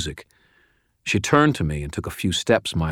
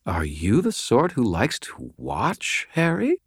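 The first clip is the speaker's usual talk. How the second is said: slow, higher seductive woman's voice; sultry teasing; 5 semitones higher than usual. Acted. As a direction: tease him slowly, lifting the end. The sexy smile widening.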